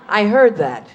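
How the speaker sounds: ordinary talk, nothing marked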